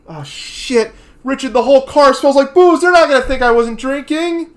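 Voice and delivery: panicked. Retold or imitated. Voicing panicked Tommy.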